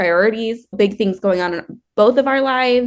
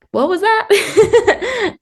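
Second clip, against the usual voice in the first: slow, high Southern drawl with a laugh; 12 semitones higher, 3 dB louder, much slower than usual. Retold or imitated. Father-in-law asking what a word means, Southern drawl. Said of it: "in his really great Southern drawl".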